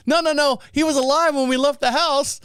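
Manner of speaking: high, insistent acted protest; mock protest. Men insist to clerk, high mock protest. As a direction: protest upward as a flustered scammer.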